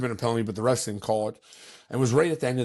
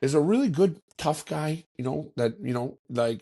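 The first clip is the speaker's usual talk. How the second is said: higher, preachy imitation of the league official; lecturing official; 6 semitones higher than usual. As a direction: mimic the official praising a classy player. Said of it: "He goes".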